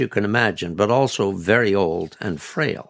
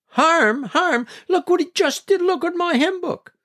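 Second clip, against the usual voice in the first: very high-pitched, indignant outburst; outraged retort. A narrator's reading. Grandmother answering grandfather about her hymn book, outraged. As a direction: fling it back in a high, scandalized voice.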